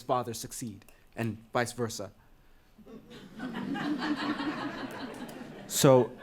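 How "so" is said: high, breathy little laugh before going on; nervous laugh. Acted. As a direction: laugh off the tension.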